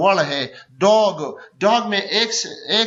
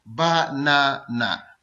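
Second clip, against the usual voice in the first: Banana very slow and lower, ब-ना-ना; syllable by syllable; 5 semitones lower, much slower than usual. Shown as a demonstration. Teacher demonstrating a three-syllable word, banana slowly split as ब-ना-ना. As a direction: hold each of the three beats in banana. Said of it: "banana three syllable word है".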